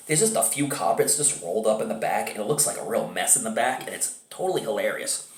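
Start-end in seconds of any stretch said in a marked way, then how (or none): none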